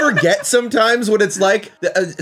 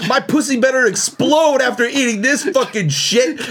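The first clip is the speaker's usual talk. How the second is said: imitating a rude friend; 6 semitones higher than usual. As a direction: ham up a crude complaint. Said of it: "Stamper's just like".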